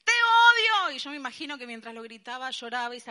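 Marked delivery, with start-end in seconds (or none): 0.0-0.9 much higher and louder, hurling the words at God; a grieving mother screams at God, much louder and higher; screamed hatred. Retold or imitated. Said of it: "empieza a gritar esto tan horrible".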